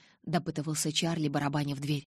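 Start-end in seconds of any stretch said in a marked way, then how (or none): none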